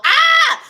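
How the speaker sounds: sudden high yelp of disbelief; loud yelp. Real, not acted. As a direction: burst out in shock. Outburst after story.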